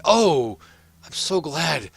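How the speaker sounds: high, loud, gushing greeting; overjoyed young lord. Acted. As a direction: burst out with delighted relief. Young lord greets servant on return.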